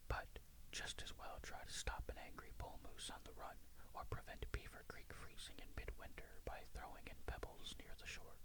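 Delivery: plain reading voice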